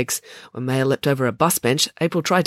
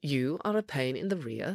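much softer, controlled put-down; cool insult; 10 dB quieter than usual. Acted. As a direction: deliver the insult quietly and evenly. Ingrid coolly insults yelling Maya.

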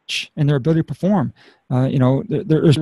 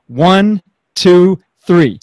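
loud, higher count to three; energetic countdown; 4 semitones higher, 8 dB louder, much slower than usual. Real, not acted. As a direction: count it out big to cue the claps. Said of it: "it's just bringing some energy".

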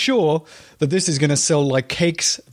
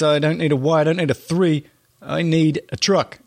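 ordinary talk

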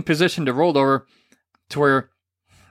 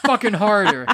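mock eager demand; 7 semitones higher than usual. Wife urging harder, retold.

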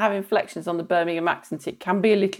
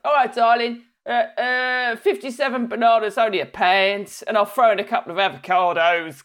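lightly done Cockney stall holder; Cockney fruit seller; 3 semitones higher than usual. Retold or imitated. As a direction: hawk bananas like a Cockney trader. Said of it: "sounds like".

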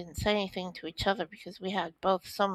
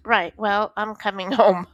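louder, a laugh breaking in on home; imitated father, louder with a laugh; dad deciding; 10 dB louder than usual. Father voiced deciding to come home.

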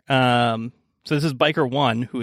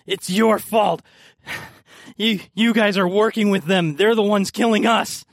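accusing NPC; 7 semitones higher than usual. Acted.

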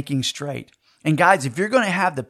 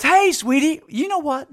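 lightly voiced wife, higher pitch; Karen; 8 semitones higher than usual. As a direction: imitate a cheery wife on the phone. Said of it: "And Karen goes".

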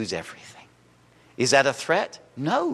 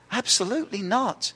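ordinary talk

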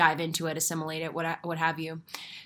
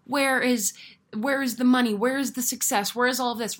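higher, louder mock-angry questions; frustrated demand; 6 semitones higher, 4 dB louder than usual. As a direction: demand answers from the universe, half-acted frustration. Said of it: "I'm a little angry".

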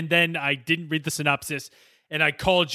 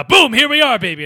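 loud and high burst of hype; hyped shout; 9 semitones higher, 7 dB louder than usual. Acted. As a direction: blurt it out all wired. Hyped up on cocaine.

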